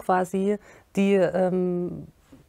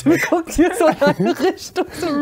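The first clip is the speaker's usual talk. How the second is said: speaking through laughter, higher and louder; laughing; 9 semitones higher, 6 dB louder than usual. Real, not acted. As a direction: get the words out while still laughing. Laughs at guest's glance. (lachend gesprochen)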